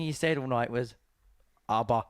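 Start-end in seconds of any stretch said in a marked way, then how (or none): 1.7-2.0 deep Swedish voice. Retold or imitated. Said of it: "His deep Swedish voice".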